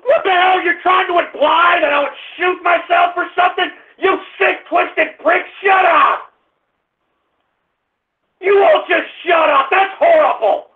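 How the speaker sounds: high-pitched exasperated rant; angry outburst. Real, not acted. Host yelling at an insulting caller, angry outburst.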